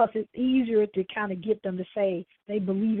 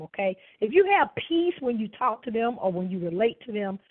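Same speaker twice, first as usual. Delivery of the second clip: usual speaking voice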